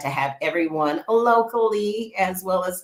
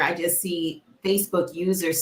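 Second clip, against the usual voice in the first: ordinary delivery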